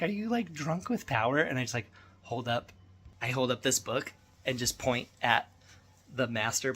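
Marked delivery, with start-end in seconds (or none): 0.0-1.4 high mock-accusing voice; teasing wife. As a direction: needle him like a skeptical wife. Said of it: "She's like".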